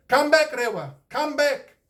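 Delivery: high, loud call up into the sky; calling a bird back from far away; crying out. A narrator's reading. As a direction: shout after him as he flies off. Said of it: "cried the tūhunga".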